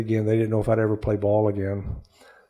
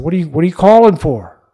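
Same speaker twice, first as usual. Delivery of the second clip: higher, louder, incredulous; astonished question; 5 semitones higher, 9 dB louder than usual. Retold or imitated. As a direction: blurt it out in retold surprise. Astonished at dad's call, retold.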